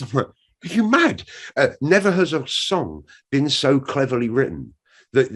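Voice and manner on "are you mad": high, indignant disbelief; raised-pitch scoffing comeback; indignant retort. Retold or imitated. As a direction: fire back as if they're crazy.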